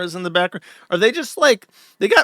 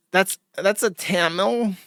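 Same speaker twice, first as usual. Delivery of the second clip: a snob explaining castes, slow and mocking; mock Indian accent; 2 semitones higher than usual. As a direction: lecture slowly like a snob, mocking. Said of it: "an Indian would be like".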